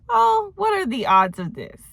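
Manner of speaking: high-pitched, put-on wonder at a coincidence; mock amazement. Vlogger reacting to a chance meeting, mock amazed. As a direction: act out exaggerated surprise, pitch way up.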